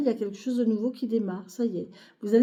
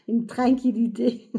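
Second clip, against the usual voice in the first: drawn-out, playful Québécois "tranquillité" through laughter; slow Quebec twang on "tranquillité", amused; accent québécois; 2 semitones higher, 5 dB louder than usual. Pretend. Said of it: "Qui c'est qui me regarde au Québec?". (accent québécois appuyé et amusé)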